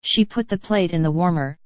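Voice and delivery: plain reading voice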